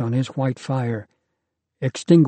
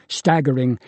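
usual speaking voice

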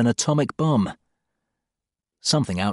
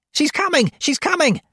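high, urgent frantic cry; frantic warning; 3 dB louder than usual. Acted. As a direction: shout the warning in a panic. Godal waving frantically, warning.

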